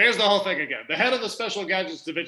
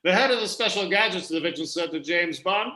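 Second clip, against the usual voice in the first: ordinary talk, nothing marked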